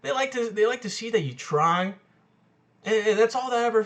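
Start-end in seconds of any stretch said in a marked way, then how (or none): none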